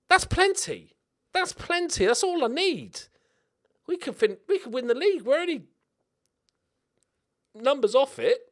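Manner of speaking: high, giddy excitement; excited. Real, not acted. To viewers, dreaming of winning the league, high and giddy. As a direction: get carried away, pitched right up. Hyped about short season.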